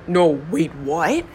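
normal talking voice

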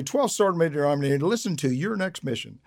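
ordinary talk, nothing marked

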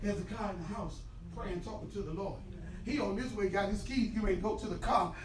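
normal talking voice

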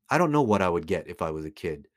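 ordinary talk, nothing marked

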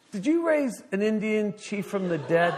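high, loud confrontation; incredulous scolding. Retold or imitated. Pastor Bob confronts young minister, incredulous.

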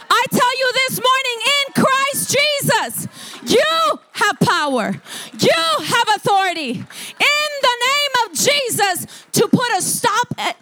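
high, drawn-out impassioned declaration; full-force preaching. Real, not acted. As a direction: proclaim authority to the congregation.

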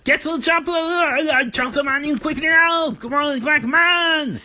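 high hammed-up Arnold yelling orders; Arnold Schwarzenegger. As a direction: hurry the kitchen like an action hero.

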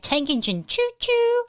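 high train-whistle style call; playful shout-out. Real, not acted. Host greets another patron joining live, playful hooted call. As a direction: hoot the name out high like a whistle.

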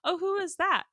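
high, prying mock question; mock nosy question. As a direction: ask like a busybody. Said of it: "I'm gonna say".

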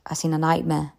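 usual speaking voice

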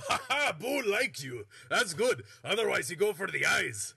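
Minsk laughing. Acted. GM as Minsk praising Boo, loud and jolly. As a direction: chuckle then warn cheerfully. GM voicing Minsk about hamster.

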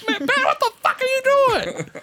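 very high, laughing mock yell; squawked mock exasperation; comic outrage. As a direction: retell an incredulous argument at high pitch. Retold row over speeding ticket.

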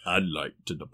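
low, soft, half-hearted Vader rumble; a lightly voiced Darth Vader. Guy trying bank line, mock Darth Vader voice. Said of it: "It sounds like Darth Vader every time".

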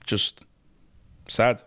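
normal talking voice

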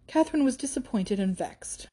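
usual speaking voice

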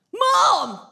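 imitating a six-year-old yelling for mum; high, loud mock holler; child's shout. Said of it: "she shouts at me".

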